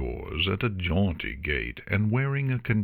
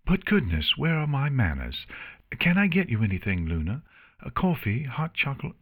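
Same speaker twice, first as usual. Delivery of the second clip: higher-pitched, polite hostess voice; eager hostess Octavia; 7 semitones higher than usual. Acted. Octavia to her royal guest, eagerly hospitable. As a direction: offer refreshments eagerly.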